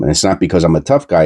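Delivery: usual speaking voice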